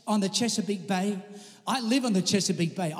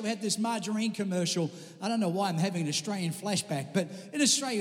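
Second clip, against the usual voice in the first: ordinary talk, nothing marked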